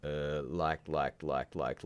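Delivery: lightly mocked dimwit, stuck on one word; a hint of low, dopey stalling; playing dumb. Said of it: "playing completely dumb and just sitting there going".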